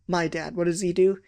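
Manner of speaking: ordinary delivery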